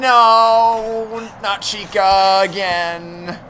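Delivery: high panicked yell, drawn out; yelling while running away. Real, not acted. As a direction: scream in panic as Chica appears.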